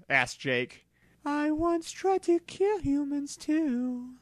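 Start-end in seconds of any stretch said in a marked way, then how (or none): 1.2-4.1 high, slow psychic creature voice; a lofty legendary creature speaking slowly from up high; Mewtwo voice. Acted. Mewtwo tells the trainers of his past, high and slow.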